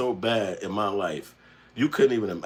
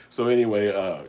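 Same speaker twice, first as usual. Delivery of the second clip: ordinary talk, nothing marked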